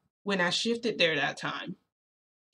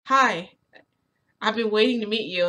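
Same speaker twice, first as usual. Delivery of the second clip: raised, louder voice of a keen fan; eager greeting; 4 semitones higher, 6 dB louder than usual. Acted. Midoriya runs up to meet the narrator, eager. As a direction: greet someone you've been dying to meet.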